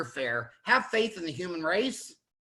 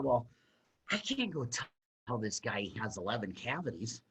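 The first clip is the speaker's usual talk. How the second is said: hushed, low and hesitant; mock dread, voice sinking very quiet; reluctant young dentist; 9 semitones lower, 9 dB quieter than usual. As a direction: barely get the bad news out. Retelling his reluctance to tell a patient.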